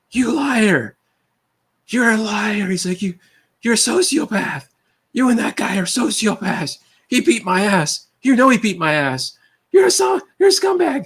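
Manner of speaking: high, tearful-sounding accusing screech in a put-on voice; mock screeching. The accused man screams at the narrator, high and put-on. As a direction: imitate a man shrieking accusations. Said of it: "screeches ... screaming ... in tears".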